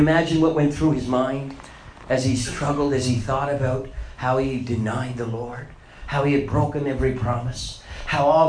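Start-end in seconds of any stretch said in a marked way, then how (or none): none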